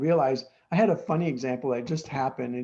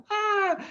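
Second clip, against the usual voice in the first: sudden high startled cry; a yelp of alarm at the runaway dog; startled shriek. Retold or imitated. Old lady realizing her dog runs into the street, startled high cry.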